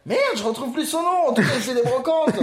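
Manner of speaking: high-pitched outburst, giving up on a name; amused exasperation. Real, not acted. Host can't remember a TV character's name, high amused exasperation. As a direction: blurt it out high, ending in a laugh.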